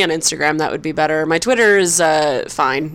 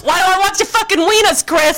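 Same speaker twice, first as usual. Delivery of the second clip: mock angry shout; 9 semitones higher than usual. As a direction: shout back in fake offense. Mock outrage back.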